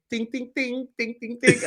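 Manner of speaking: high sung ding ding; high, light sung dings. Retold or imitated. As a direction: sing the little music cue. Imitating music cue.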